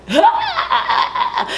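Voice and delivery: high mock scream while running. Sally fleeing Leatherface, shrill mock scream. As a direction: let out a hammed-up shriek. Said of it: "she's like".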